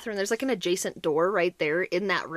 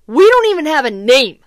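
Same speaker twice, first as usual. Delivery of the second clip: much louder, emphatic outburst; exasperated alarm; 3 semitones higher, 13 dB louder than usual. Acted. Mom tops her list of problems, loud exasperation. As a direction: belt it out in comic desperation.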